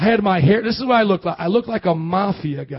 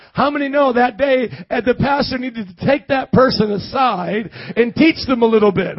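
ordinary talk

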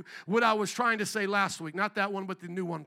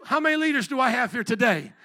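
asking again, much louder; louder repeat; 2 semitones higher, 7 dB louder than usual. Real, not acted. Preacher pressing a small congregation, louder call. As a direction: push the crowd with a louder call. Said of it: "Come on, four of you?".

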